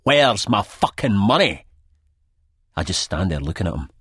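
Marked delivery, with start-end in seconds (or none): 0.0-1.7 higher, louder, slow furious question; angry demand. A narrator's reading. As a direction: hammer each word of the demand. Sick Boy demands money.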